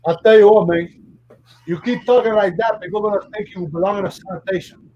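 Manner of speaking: Tony Montana mocks Gump's weird talk. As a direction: rant like Scarface in charge. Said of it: "Tony Montana meets Forrest Gump".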